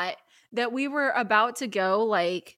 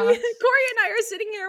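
laugh-crying; 12 semitones higher, faster than usual. Real, not acted. Laughing-crying while retelling.

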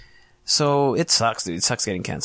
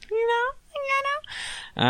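very high squeaky falsetto; falsetto squeak; 4 dB quieter, much slower than usual. Pretend. Host squeaking about his slogan in falsetto. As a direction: squeak it like a cartoon.

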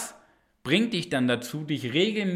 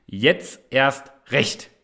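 slow, each word hammered, stress on erst; a slow rallying motto; emphatic slogan; 3 dB louder, much slower than usual. Shown as a demonstration. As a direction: drag out jetzt erst recht with erst punched. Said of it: "brauchen wir den Glaubenssatz jetzt".

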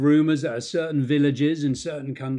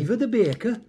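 put-on Welsh accent; 8 semitones higher than usual. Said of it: "What was that you said about a Welsh accent?".

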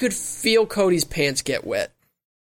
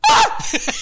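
high startled shout; excited yell; 4 dB louder than usual. Retold or imitated. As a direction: yell out in shock. Reacting to punch on couch.

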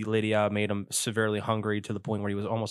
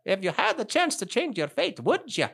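high imitation of a Scottish movie quote; careful, higher-pitched Scottish accent try; Scottish attempt; 8 semitones higher than usual. Shown as a demonstration. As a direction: copy the line in amateur Scottish. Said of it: "like Scottish".

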